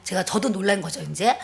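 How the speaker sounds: ordinary talk, nothing marked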